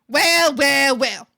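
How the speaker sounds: loud Newfie accent imitation; hammed-up Newfie accent. Shown as a demonstration. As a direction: put on a mock Newfoundland accent. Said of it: "you talk about the Newfie one".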